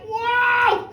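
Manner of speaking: high, loud wow; amazed crowd. Acted. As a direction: gasp out amazement like a cheering crowd. Crowd amazed by dog's win.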